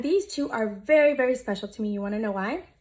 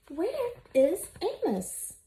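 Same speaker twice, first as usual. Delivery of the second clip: worried animals; 6 semitones higher, slower than usual. Acted. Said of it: "the animals wondered".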